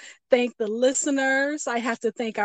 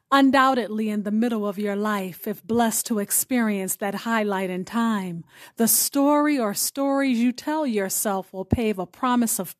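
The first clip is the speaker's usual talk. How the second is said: poem reading; 3 semitones lower than usual. Acted. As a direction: read it gently like a closing blessing. Host reads her poetic offering.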